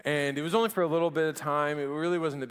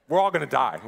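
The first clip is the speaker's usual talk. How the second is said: put-on repeating the kid's line; 8 dB louder than usual. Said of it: "turns to her in the car and goes".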